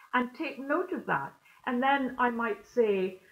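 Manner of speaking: normal talking voice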